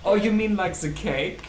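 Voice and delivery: high, loud mock-pedantic voice; mock German correctness. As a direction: overly correct German accent. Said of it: "overtly German correct".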